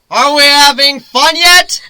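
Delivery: high, slow, loud mocking yell; teasing shout. Griffin taunting falling Lyra, slow high teasing shout. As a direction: holler a cheeky taunt into the wind.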